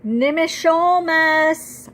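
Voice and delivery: high, loud, slow call into the forest; calling out desperately for him; frantic call. A narrator's reading. Girl searching the woods for great-grandfather, frantic loud call. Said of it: "I call frantically".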